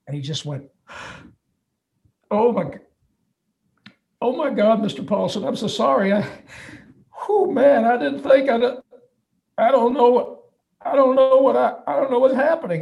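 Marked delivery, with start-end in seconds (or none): 2.3-12.8 higher, choked-up apologizing; a big guy fighting back tears; tearful fan. Retold or imitated. Biker fan overwhelmed at meeting his heroes, tearfully stammering. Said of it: "he started to tear up".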